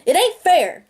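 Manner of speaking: a cry against unfairness; passionate cry. Acted. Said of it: "I cried passionately".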